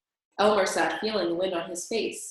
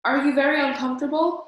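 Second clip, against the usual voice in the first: higher and louder question; screaming over the storm; 6 semitones higher, 6 dB louder, faster than usual. A narrator's reading. Elmer to the dragon in the storm, screaming. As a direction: shout so the dragon hears above the noise. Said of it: "he screamed above the noise in the storm".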